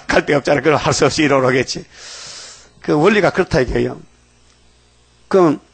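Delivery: usual speaking voice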